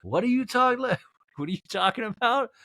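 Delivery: high, confused mock bafflement; high, laughing mock confusion of a younger boyfriend; baffled. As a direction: sputter like you can't believe what you heard. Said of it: "Corey's like".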